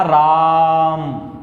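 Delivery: राम stretched very long and slow, vowel held; drawn-out Ram. Shown as a demonstration. Teacher showing students the long pluta vowel, slowly drawn राम. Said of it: "ऐसे करके".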